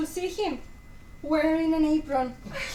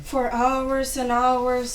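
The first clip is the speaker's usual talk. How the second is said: slow, dragging complaint; drawn-out weary; 3 semitones lower, 3 dB louder, much slower than usual. Acted. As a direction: stretch every word out. Drawn-out complaint about queues.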